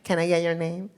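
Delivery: barista. Retold or imitated. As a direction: ask it like a busy cashier. Barista asks.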